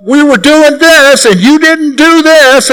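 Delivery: slow, high whiny complaint; mock-whiny grievance. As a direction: mimic his own grumbling at God, high and slow. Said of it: "I quote".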